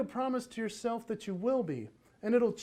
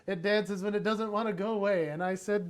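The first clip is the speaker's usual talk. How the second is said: usual speaking voice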